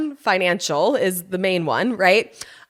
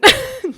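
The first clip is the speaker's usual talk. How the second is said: high squeal of laughter; 6 dB louder than usual. Real, not acted. Laughing about crying.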